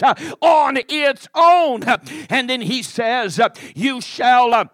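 insistent revival-preacher cadence, swelling in places; forceful, rhythmic sermon delivery; fervent preaching. Acted. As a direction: drive each short phrase home like a preacher.

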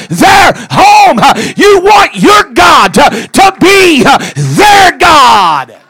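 loud, slow, shouted sermon cadence; full-force preaching. Acted. Preacher hollering to the congregation, drawn out.

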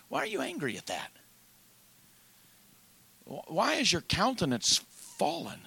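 low, soft, measured voice of God; God quietly questioning Cain's offering; God pondering. Acted. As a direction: speak as a grieved God reasoning with Cain. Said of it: "the Lord is pondering something".

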